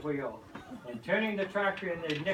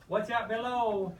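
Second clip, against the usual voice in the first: hollering; 5 semitones higher than usual. Acted. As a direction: holler a warning from above. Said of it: "Penny hollered".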